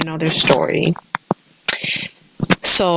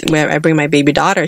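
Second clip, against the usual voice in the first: normal talking voice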